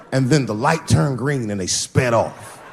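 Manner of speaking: usual speaking voice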